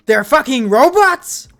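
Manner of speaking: slow, high, loud disbelief; shocked shout. Acted. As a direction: yell it in shock. Shocked discovery.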